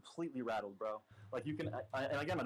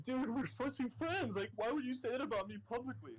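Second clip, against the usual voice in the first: much higher, pleading mock-tearful voice; imitating crying; 10 semitones higher than usual. Hurt friend protesting public insults, imitated bawling. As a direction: mimic a bawling friend in a high-pitched protest. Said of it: "Like, crying".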